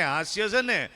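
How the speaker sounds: ordinary talk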